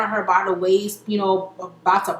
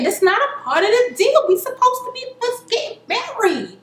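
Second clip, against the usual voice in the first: put-on whiny pleading girlfriend; 10 semitones higher than usual. Brooke pleading, voiced high.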